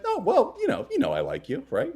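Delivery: high, wavering mock-mom voice; flustered mother. Mother offering her son a like instead, flustered. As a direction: start high and hedge your way down. Imagined awkward mother dodging.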